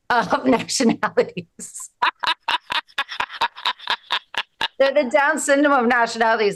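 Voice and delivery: fast, giggling through the line; laughing. Real, not acted. Comic repeating her own punchline, laughing through it. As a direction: crack up while repeating it.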